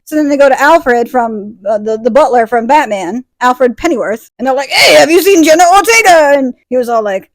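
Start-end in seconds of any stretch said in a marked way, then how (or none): 4.7-6.4 high, loud put-on hail; mock calling out. Vampires hail the butler about Jenna, loud mock call. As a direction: call out loudly in an acted voice.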